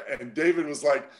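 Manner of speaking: usual speaking voice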